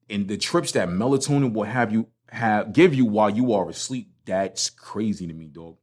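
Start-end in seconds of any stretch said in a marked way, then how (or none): none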